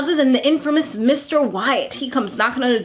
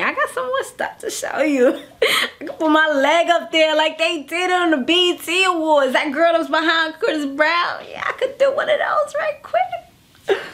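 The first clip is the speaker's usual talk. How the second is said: high, laughing show-off voice; playful boasting; 5 semitones higher than usual. Acted. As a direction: brag it up, pitch climbing into laughter. Imagined boastful come-on, playfully acted.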